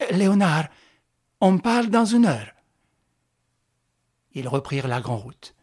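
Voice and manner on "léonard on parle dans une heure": raised voice calling across the yard; a call to Léonard from a distance. Acted. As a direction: call out to the driver, louder and higher. Calling to the driver.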